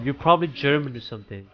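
normal talking voice

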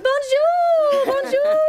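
loud, high French "Bonjour" greeting; French accent. Acted. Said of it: "the fancy French accent".

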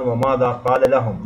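ordinary talk

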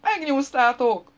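high, loud, drawn-out yell; howl of agony. A narrator's reading. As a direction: howl it out in pain. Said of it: "üvöltött kínjában". (elnyújtott, fájdalmas üvöltés)

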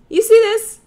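high, loud indignant demand; loud, high outrage over a tiny muffin. Acted. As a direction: shove it in their face, outraged.

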